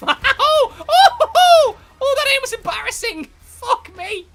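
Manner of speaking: very high, loud outburst; real shrieking embarrassment; shouted dismay. Real, not acted. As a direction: howl at your own blunder. Reacts to own bad aim.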